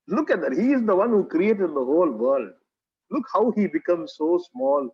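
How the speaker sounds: ordinary talk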